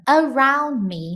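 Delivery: slow, stretched around me, with the diphthong in "Around" drawn out; teacher modelling "Around" slowly; careful around me. Shown as a demonstration. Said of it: "Esse around".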